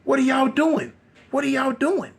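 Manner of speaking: high-pitched, retold indignant hollering; hollering at her. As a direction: holler in disbelief at crazy captors. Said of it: "they hollering at her".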